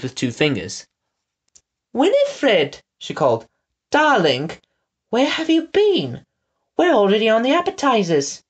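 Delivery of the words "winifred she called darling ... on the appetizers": much higher, clenched-teeth society voice; teeth-clamped society lady. A narrator's reading. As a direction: call out like a grand lady through locked teeth. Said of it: "her teeth were all stuck together".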